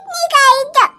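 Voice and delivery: ordinary delivery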